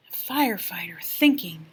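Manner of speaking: usual speaking voice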